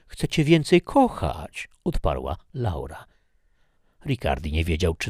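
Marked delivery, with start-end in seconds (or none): 0.1-1.7 higher, slow and sweet; coaxing. Acted. Laura sweet-talks Ricardi into freedom, slowly and coyly. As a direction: melt him with a tender promise.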